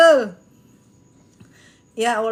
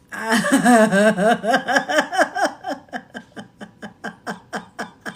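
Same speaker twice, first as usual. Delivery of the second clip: helpless laughing fit; 5 semitones lower than usual. Real, not acted.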